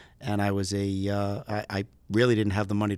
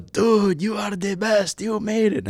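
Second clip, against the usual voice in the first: put-on high-pitched, jubilant Greek-accented cheer; heavy Greek accent; 12 semitones higher, 4 dB louder, slower than usual. Instructor cheering his student over the radio, high Greek accent. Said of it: "in a very heavy accent".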